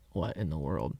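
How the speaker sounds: low, soft aside imitating a quiet dad; imitated quiet man, privately baffled; quiet bewilderment. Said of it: "my dad's real quiet".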